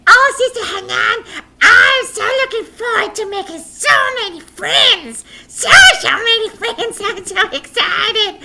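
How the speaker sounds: very high, loud, laughing puppet squeal; excited puppet. Acted. Puppet boy tells teacher about new school, squealing. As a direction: gush with giggly glee.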